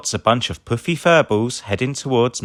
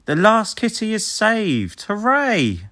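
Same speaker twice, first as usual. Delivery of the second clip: much higher, slow cheer; 12 semitones higher, slower than usual. A narrator's reading. Rubble cheering the saved kitty, higher. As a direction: celebrate the win.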